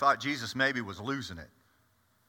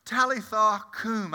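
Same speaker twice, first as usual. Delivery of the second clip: stunned echo; 6 semitones higher than usual. Real, not acted. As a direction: repeat "Talitha cum" as if you can't believe it. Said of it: "I couldn't believe it".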